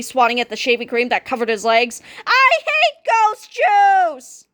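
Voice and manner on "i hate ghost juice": very high, slow shout; anguished shout. A narrator's reading. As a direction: howl it in disgust. Pests panicking in foam.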